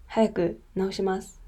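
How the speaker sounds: usual speaking voice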